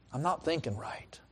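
a hushed plea to God; whispered prayer. Retold or imitated. Preacher recalling praying to the Lord, whispered. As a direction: breathe it out like a confession.

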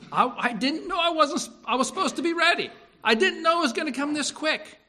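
high, stammering excuse, caught off guard; Annalise. Retold or imitated. Annalise caught unready in the dramatized story, high flustered excuse. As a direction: imitate someone protesting, pitched well up. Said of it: "We were just dramatized in this story here that, uh, Annalise says".

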